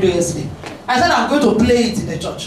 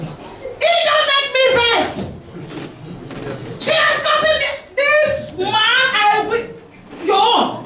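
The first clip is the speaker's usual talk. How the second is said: high-pitched, exasperated quarrelling; acted marital outrage; 9 semitones higher than usual. Angry spouse to worst partner ever, acted high-pitched outrage. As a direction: rail at your spouse in a high, indignant voice.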